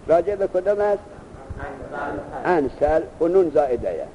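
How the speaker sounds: ordinary talk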